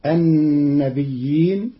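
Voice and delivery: "النبيين" said an-nabi'iin, light ya then hamza, slowly. Shown as a demonstration. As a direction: stretch "النبيين" and sound the hamza. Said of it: "بتخفيف الياء ساكنة مع همز بعدها". ("النبيين" ببطء بهمز بعد الياء الساكنة)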